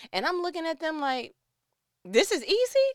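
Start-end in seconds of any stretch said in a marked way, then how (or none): 2.0-2.9 high, loud disbelieving question; can't believe it's called easy; disbelief. Retold or imitated. Child answering mother's lecture, loudly disbelieving.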